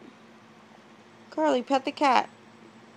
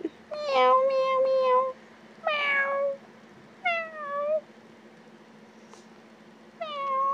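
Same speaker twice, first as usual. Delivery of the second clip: tiny high meows; 14 semitones higher, much slower than usual. Retold or imitated. Parent meowing to kids, very high fading kitten. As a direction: meow like a little kitten, trailing off.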